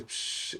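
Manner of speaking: soft hissed "psh"; imitated noise. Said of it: "I just hearing noise".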